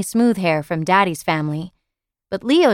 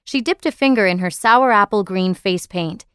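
usual speaking voice

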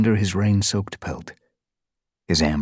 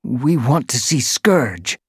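slow, high, determined request; firm demand; 8 semitones higher, 5 dB louder, slower than usual. A narrator's reading. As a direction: stand your ground before the guard. Boulder stands his ground.

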